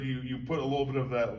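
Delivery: normal talking voice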